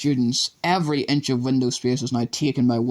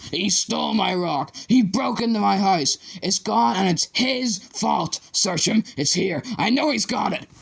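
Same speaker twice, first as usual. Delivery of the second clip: higher, slowed, frantic raving; ranting accusation; 9 semitones higher than usual. A narrator's reading. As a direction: rave it, every line an accusation. Raving teacher accusing the pupil.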